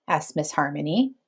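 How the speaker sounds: usual speaking voice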